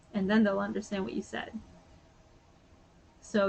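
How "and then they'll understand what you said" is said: ordinary talk, nothing marked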